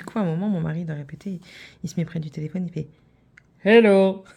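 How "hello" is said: hammed-up loud imitation of the husband; shouted hello. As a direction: yell it at the phone like the husband. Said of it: "T'es pas obligé de crier comme ça".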